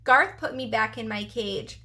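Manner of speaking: usual speaking voice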